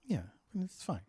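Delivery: soft whispered reassurance. Real, not acted. Reassuring the embarrassed friend, whispered. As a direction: whisper it gently, barely there.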